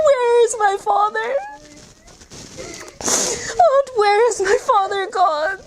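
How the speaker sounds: put-on high, weeping plea of a little girl; tearful child's plea. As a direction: cry out a small girl's longing for her father. Sakina weeping for her father.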